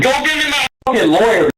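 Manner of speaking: rapid, high-pitched angry demand. Real, not acted. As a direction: fire it back in a rush. Suspect demands lawyer in quarrel.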